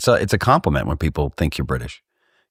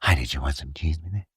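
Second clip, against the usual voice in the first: apologetic waiter; 3 semitones lower, 8 dB quieter, faster than usual. Retold or imitated. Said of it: "an apologetic nature to it".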